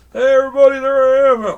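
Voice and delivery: high, loud puppet cheer; excited greeting. Pretend. Santa figure popping up to greet all the kids, high and loud. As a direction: burst in with a big cheery hello.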